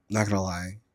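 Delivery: ordinary talk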